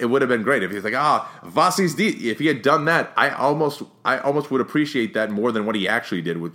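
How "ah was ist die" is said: high, loud mock German accent; over-the-top German accent. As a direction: ham up a German accent as a movie-villain parody. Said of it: "over the top with the German accent".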